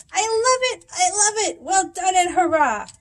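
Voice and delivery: high, loud, delighted cheering; squealing with delight; excited cheer. A narrator's reading. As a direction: cheer it like a thrilled kid. Skinny Doug's excited cheer.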